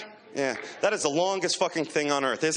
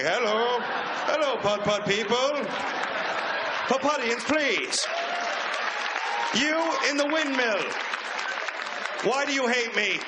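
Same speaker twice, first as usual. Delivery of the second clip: high grandiose greeting; 5 semitones higher than usual. Retold or imitated. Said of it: "I was like".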